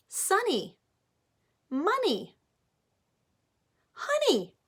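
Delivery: each rhyme swooping up high, excited; eager rhyme answers. Acted. Teacher praising kids' rhyming answers, excited.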